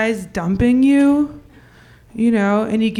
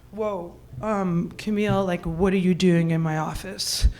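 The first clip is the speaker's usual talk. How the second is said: a softer, lower, caught-out reply; startled hushed man; 7 semitones lower, 8 dB quieter than usual. Retold or imitated. David to Camille in his office, startled and hushed. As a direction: hush it like you've been caught.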